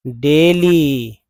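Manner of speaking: Daily drawn out very slowly, falling; slow model word. Shown as a demonstration. Teacher drills daily slowly for students. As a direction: stretch out daily syllable by syllable. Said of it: "क्या इसे daily नहीं पढ़ते हैं".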